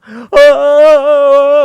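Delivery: loud, high, drawn-out mock wail; hammed-up zombie daughter's bawling; imitated crying. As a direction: wail long and loud, acted. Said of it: "하면서 막 울어".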